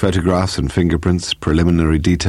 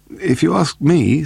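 slow, high piped interjection; piping; 10 semitones higher, slower than usual. A narrator's reading. Scott Reed from his chair to the inspector, piping. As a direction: pipe up in a higher voice. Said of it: "Scott Reed piped surprisingly".

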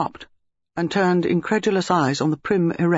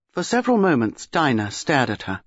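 ordinary delivery, nothing marked

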